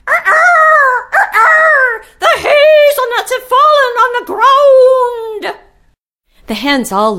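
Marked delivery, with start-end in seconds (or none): 0.1-5.6 much higher, louder rooster calling his hen. Acted. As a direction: crow it out like a proud rooster. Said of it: "I heard him call".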